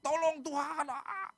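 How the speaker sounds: high acted wail for help; hammed-up howl of desperation; wailing and groaning. Israelites groaning to God for rescue, mock wailing. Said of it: "Alkitab bilang meraung".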